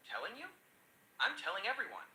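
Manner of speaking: mildly acted delight; beaming old man. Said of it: "The old man beams and says".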